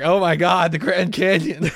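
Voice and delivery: loud, high exclamation breaking into laughter; mock panic. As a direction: cry out in hammed-up alarm. Imagined panic at the casino.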